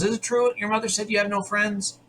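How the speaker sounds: lightly acted, probing question; MacGyver's questioning. MacGyver asks the girl about her friends, lightly acted questioning. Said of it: "that's what MacGyver says".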